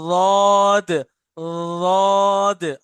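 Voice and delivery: drawn-out heavy Arabic d sound; demo of Arabic letter. Shown as a demonstration. Teacher drawing out the letter for the class, slow and loud. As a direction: model the letter slowly for students. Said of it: "ये sound ही अलग है बिल्कुल".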